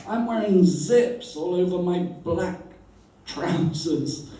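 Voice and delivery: ordinary talk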